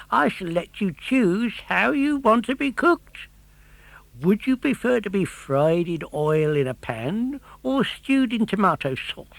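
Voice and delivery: high, far-off sly fisherman voice; mock-polite fisherman. A narrator's reading. Fisherman offering puppet a cooking choice, mock-polite.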